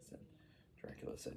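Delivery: ordinary delivery, nothing marked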